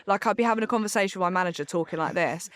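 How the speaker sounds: ordinary delivery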